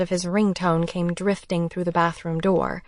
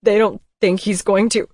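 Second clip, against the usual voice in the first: sobbing; 2 semitones higher, 7 dB louder than usual. Acted. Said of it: "A loud sob broke my thoughts".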